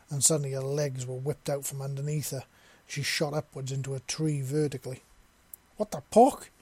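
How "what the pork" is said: high, very loud cry of surprise; shocked shriek; startled yell. A narrator's reading. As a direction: yelp it as she's yanked upside down. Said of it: "she yelled".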